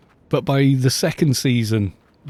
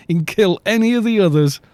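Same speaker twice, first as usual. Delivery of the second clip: warning to the writers; 6 semitones higher than usual. Retold or imitated. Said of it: "where I went".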